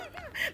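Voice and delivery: a quick, sharp burst of laughter; sudden embarrassed giggle; short high laugh. Real, not acted. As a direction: laugh it off in one high breath. Laughs at her own fib.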